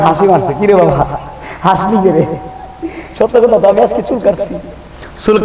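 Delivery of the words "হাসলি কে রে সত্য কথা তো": ordinary delivery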